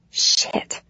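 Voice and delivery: breathy whispered swear under the breath; whispered curse. A narrator's reading. Narrator cursing herself under her breath, whispered. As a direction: hiss it to yourself in frustration.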